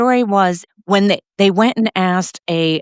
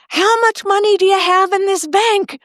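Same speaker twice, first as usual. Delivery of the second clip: imitated yell; 13 semitones higher than usual. Sister-in-law rushing into the bank to get bail, mock yelling. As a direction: call it out high across a room. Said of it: "my sister-in-law yells".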